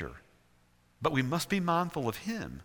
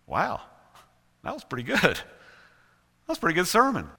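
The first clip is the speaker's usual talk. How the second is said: pitched-up mock amazement; impressed with oneself; 6 semitones higher, 4 dB louder than usual. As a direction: marvel at your own work, drawing it out. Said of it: "and I'll go".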